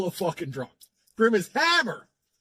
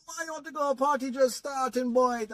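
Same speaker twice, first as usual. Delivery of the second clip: put-on raised, Jamaican-accented drunk protest; 8 semitones higher than usual. Drunk friend Grim refusing to leave the party, in a high Jamaican accent. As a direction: sing it up like a drunk Jamaican mate who won't leave.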